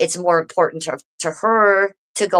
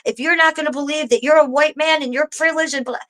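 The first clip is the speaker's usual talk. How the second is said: mock angry rant; 8 semitones higher than usual. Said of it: "And she said".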